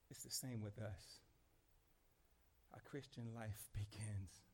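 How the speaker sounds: low, very soft, reflective sermon; hushed preaching. Real, not acted. Pastor speaking of the soul's search for God, hushed. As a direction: bring the room down, quiet and intimate.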